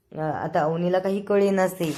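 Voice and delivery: ordinary delivery, nothing marked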